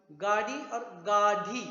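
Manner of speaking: गाड़ी plain, गाढ़ी breathy, said slowly; slow contrast of गाड़ी and गाढ़ी. Shown as a demonstration. Teacher slowly contrasting गाड़ी and गाढ़ी. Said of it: "ढ आ गया".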